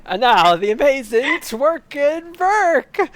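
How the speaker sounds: circus announcer. Acted. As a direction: announce it like a ringmaster, very slow.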